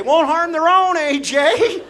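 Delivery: high, loud mock panic; hammed-up frantic self-reassurance; panicked self-talk. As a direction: shriek it in acted desperation.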